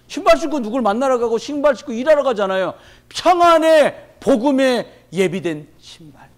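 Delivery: loud, high, impassioned sermon delivery; fervent preaching. Acted. Pastor urging the congregation toward the shoes of peace, fervent loud preaching. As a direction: preach it out, rising with fire.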